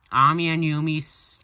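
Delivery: plain reading voice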